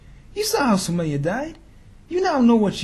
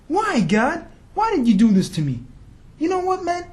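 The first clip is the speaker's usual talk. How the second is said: higher, petulant protest to God; mock whining complaint; 8 semitones higher, 4 dB louder than usual. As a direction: play the sulky fair-weather believer. Mock complainer quits prayer.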